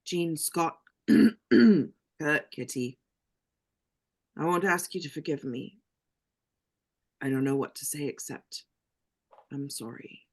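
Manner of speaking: choking back tears. Acted. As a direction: hold back tears as the voice sinks away. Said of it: "Xavier chokes back tears".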